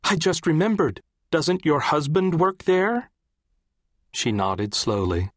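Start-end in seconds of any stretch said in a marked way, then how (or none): 0.0-3.1 high-pitched, urgent colleague's voice; crying out. A narrator's reading. Colleague asks Joyce about her husband at the tower, crying out high. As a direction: pitch it high, rising into an alarmed question. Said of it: "cried a colleague".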